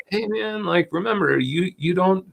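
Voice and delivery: high, soft buddy voice; soft, high-pitched pleading friend; coaxing ego. Acted. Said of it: "It's more like".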